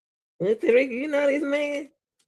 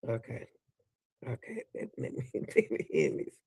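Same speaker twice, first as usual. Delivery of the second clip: breathless whisper; 10 semitones lower, 8 dB quieter than usual. Real, not acted. Winding down through laughter.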